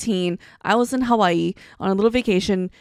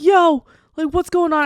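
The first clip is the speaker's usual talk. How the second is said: urgent panic; 7 semitones higher, 8 dB louder than usual. Retold or imitated. Waking up the doctor friend.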